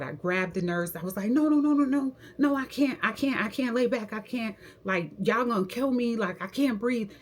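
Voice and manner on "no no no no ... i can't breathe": re-enacted panic, high-pitched pleading; freaking out. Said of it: "I started freaking out".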